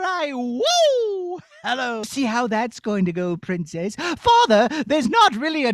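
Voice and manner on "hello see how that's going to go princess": low sly wizard voice; a scheming villain teasing. Acted. Evil wizard mocking the princess.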